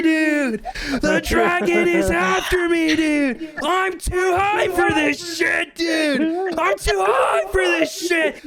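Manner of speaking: high, slow drawn-out wail of panic; hammed-up high-pitched stoner panic; terrified stoner. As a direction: wail like a freaked-out guy on drugs. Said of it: "He gets really terrified".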